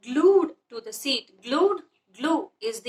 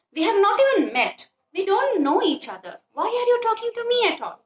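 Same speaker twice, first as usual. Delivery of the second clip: high, sharp indignant outburst; old man snapping at a stranger, lightly acted anger; angry old man; 8 semitones higher than usual. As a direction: bristle at a stranger's cheek, voice climbing high. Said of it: "So he gets angry and he goes away".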